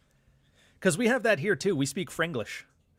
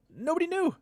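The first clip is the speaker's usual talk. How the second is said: high-pitched incredulity. Real, not acted. Learner finding nobody can spell the cuss word, high incredulous cry. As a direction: voice shooting way up high. Mock disbelief about French spelling.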